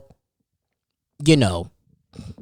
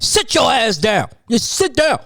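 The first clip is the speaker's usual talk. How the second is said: high, stern yelling stepdad; stepdaddy voice; 8 semitones higher than usual. Retold or imitated. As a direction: bark it like a fed-up stepdad. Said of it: "It's my best stepdaddy voice".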